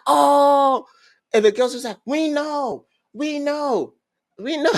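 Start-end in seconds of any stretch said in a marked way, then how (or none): 0.1-0.9 hyped cheer. Retold or imitated. Boys hyped at news. 2.1-4.7 put-on girls squealing excitedly. Girls gloating to the boys, high and squealing.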